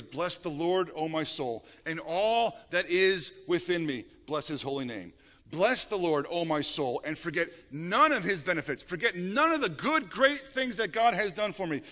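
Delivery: measured, rising psalm reading; solemn scripture read building to praise. Acted. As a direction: proclaim the psalm with building fervor. Reading Psalm 103 aloud.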